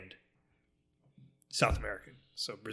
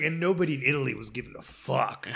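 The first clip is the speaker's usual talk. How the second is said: ordinary talk, nothing marked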